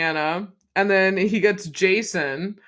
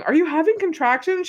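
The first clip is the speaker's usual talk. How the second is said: mock question; 11 semitones higher than usual. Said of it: "is Lucia going".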